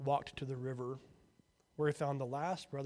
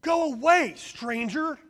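shrill scream, much higher and louder; 12 semitones higher, 15 dB louder, slower than usual. A narrator's reading. Hut brother screaming at stranger through window, loud. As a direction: yell it through the window to drive him off. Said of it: "screamed the brother through the window".

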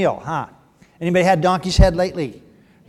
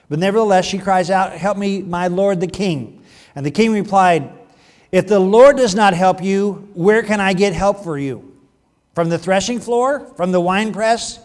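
loud, projected Bible reading; emphatic pulpit reading of scripture; 2 semitones higher, 6 dB louder than usual. A narrator's reading. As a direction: read the verse out to the whole congregation.

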